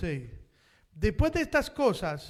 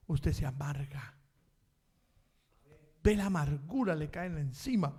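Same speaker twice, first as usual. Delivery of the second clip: quiet aside; 7 semitones lower, 5 dB quieter than usual. Acted.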